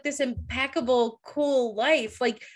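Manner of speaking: usual speaking voice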